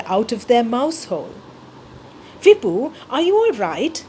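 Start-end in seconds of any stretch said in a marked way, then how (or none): 2.4-4.0 worried squeak. A narrator's reading. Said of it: "my mother squeaked".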